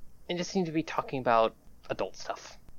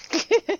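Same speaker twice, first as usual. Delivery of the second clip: laugh; 5 dB louder than usual. Acted. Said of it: "She laughs like".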